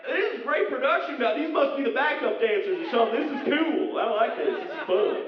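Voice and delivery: put-on high, excited Connecticut-accent cowboy. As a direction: gush like a Connecticut cowboy at a comic con. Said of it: "that Connecticut accent".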